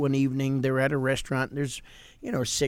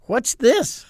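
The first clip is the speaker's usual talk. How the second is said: high, louder puzzled "What's this?"; naive question; 10 semitones higher, 6 dB louder than usual. Retold or imitated. Young preacher puzzling over his shrimp cocktail, naive surprise. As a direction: ask it with innocent bewilderment. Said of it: "he foolishly said".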